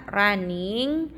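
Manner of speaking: ordinary delivery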